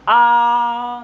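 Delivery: very slow, holding आ long; drawn-out vowel. Shown as a demonstration. Teacher demonstrating a long vowel to class, slow. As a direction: stretch बड़ा का आ out slowly. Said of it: "देर तक उच्चारित करें".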